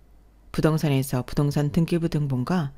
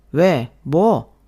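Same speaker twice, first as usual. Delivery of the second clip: loud defiant retort; husband snapping at wife's question; snapping back; 6 dB louder, much slower than usual. Acted. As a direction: throw it back at her, loud. Husband snaps back in fight.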